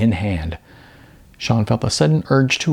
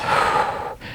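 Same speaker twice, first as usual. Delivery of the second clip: shaky exhale; 4 dB quieter than usual. A narrator's reading. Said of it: "Then Sean took a breath".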